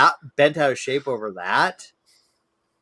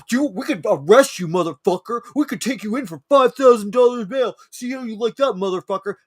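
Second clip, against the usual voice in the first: higher, louder menacing yell; mock-angry cop threatening arrest; imitated cop threat; 8 semitones higher, 5 dB louder than usual. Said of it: "He's like".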